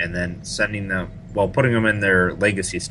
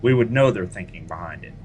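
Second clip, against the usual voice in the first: ordinary talk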